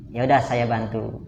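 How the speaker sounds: soft, low conciliatory husband; good listener. Acted. Husband apologising to complaining wife, softly conciliatory. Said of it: "jadilah pendengar yang baik".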